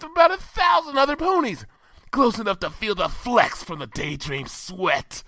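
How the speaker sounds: high, brash tomboy pony voice; Rainbow Dash voice. Acted. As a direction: pitch it up and brag with big energy.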